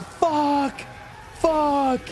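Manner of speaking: high-pitched yelled curse, re-enacting agony; reliving a scream of pain. Retelling his own cries of pain.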